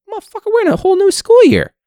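hammed-up exasperated shout; mock outburst. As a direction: blow up at the student in disbelief. Teacher answering late student.

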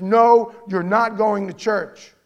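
loud, firm refusal, slowing down; forbidding husband. Retold or imitated. Unbelieving husband forbidding his wife church, loud and firm. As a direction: shut her down like a stern husband. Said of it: "the husband forbids that woman".

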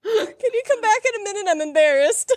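high, laughing embarrassed plea. Retold or imitated. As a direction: plead through giggles, embarrassed.

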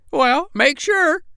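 very high parrot voice, drawn out slow; slow squawking parrot. Acted. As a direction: stretch it out like a fussy parrot. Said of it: "said Polynesia".

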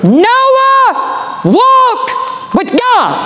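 high, ringing preacher's shout; preaching shout. Acted. Preacher proclaiming Noah's faith, full-force shout. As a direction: belt it out to the back pews.

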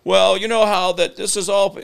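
high-pitched rambling politician imitation; Bill Clinton. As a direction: dodge the question in a high put-on voice. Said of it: "this is the worst Bill Clinton imitation".

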